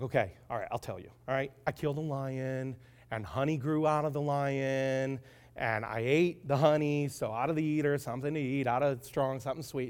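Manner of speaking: low, soft, worn-down confession; giving in. Acted. Husband finally telling his wife the secret, resigned. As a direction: explain it reluctantly, voice sunk down. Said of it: "he gave in to his wife".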